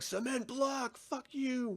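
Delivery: high, softened mock yell; mock attack cry. Attackers freaking out at the driver, mock yelling. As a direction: imitate a yell without the volume.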